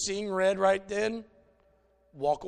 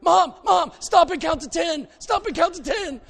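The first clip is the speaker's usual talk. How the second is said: put-on high, loud begging child voice, pleading mom to calm down; a frantic little boy crying out to his angry mother; pleading kid; 11 semitones higher, 10 dB louder than usual. Boy begging mom mid-haircut.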